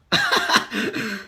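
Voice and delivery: a quick high laugh; short laugh. Real, not acted. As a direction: laugh at the absurdity. Laughs after mocking quote.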